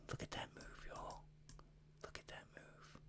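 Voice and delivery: whispered in awe; hushed amazement at the chart; awed whisper. Real, not acted. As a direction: breathe it out like a secret. Marveling at the chart move.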